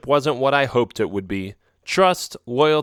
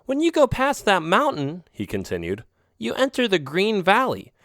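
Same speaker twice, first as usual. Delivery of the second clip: a knowing, warning alley cat; lightly voiced friend cat; 7 semitones higher than usual. Friend cat Oreo warning him off the rocks, lightly voiced. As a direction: warn him off, lightly raised voice.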